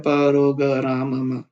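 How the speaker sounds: प, रो, ग, रा, मा split into separate syllables. Shown as a demonstration. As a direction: spell it out slowly, syllable by syllable. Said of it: "धीरे-धीरे पढ़ सकते हैं". (अक्षर-अक्षर धीरे-धीरे)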